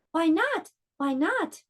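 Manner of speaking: a little monster begging to know why; curious child. Acted. Gruffalo's child asks father.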